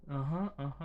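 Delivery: imitating her son. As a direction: echo the boy's eager grunted yes. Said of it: "And he says".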